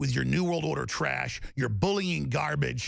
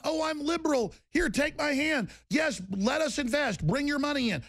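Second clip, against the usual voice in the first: mock naive voice; 9 semitones higher than usual. Mocking what leaders told Assad.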